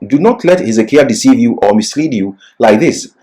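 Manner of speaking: ordinary delivery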